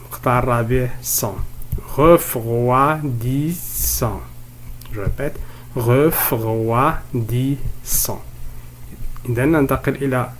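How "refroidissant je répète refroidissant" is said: "refroidissant" drawn out as ref, roi, dis, sant; very slow syllable-by-syllable "refroidissant"; split into syllables. Shown as a demonstration. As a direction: sound out each part of "refroidissant". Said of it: "On va découper ces mots en syllabes".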